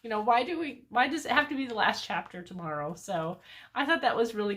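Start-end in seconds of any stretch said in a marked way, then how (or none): 0.0-2.9 put-on higher, louder whiny child voice; upset granddaughter. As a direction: plead like a kid who doesn't want the book to end. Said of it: "kind of upset about it".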